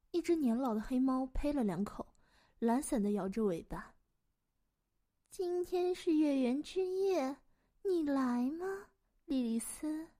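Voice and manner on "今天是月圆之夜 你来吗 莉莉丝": slow, high, languid cat voice; unhurried old cat lady; talking cat. Acted. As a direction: drag out the invitation lazily. Old cat invites the witch.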